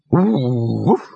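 a single eager woof; loyal dog bark. Acted. The dog answers the talking dog with a loyal woof. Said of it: "Laddie added, loyally".

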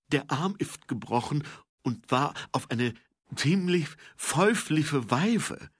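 high, thick lisp; lisping servant. A narrator's reading. Igor reporting a broken arm to Mumm, lisping servant. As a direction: lisp the diagnosis, loud and drawn out at the end. Igor lisps his diagnosis.

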